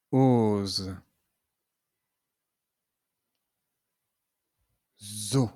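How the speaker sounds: teacher modelling z syllables; syllable drill. Shown as a demonstration. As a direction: read the syllables clearly for the class. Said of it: "Lisons maintenant ensemble des syllabes".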